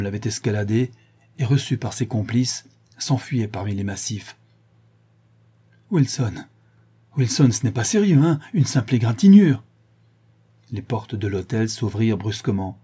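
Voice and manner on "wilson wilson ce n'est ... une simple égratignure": raised, loud, urgent appeal; alarmed pleading. A narrator's reading. Sholmes to his wounded friend Wilson, anxious loud urging. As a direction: call to him anxiously, building louder.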